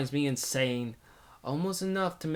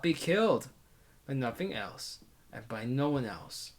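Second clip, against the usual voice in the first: plain reading voice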